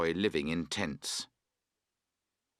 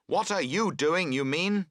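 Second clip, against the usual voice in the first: louder and higher, retorting hotly; angry answer; 8 semitones higher, 5 dB louder than usual. A narrator's reading. Friend snapping back at the camper over the tent, angry. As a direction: throw the question right back at him. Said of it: "he answers angrily".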